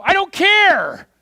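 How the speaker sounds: high, slow shout of I don't care. Real, not acted. Preacher waves off the donut jokes with a slow high shout. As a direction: bellow it out, done with it. Preacher erupts mid-rant.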